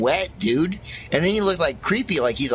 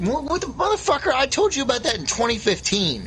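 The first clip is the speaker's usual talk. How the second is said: excited; 11 semitones higher than usual. Real, not acted. Excited outburst about aliens.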